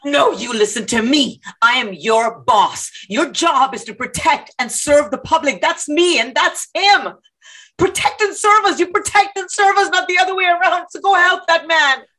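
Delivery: firm at first, building to high, urgent insistence; defiant arguing. Acted. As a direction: lecture the cop angrily. Protester argues with cop.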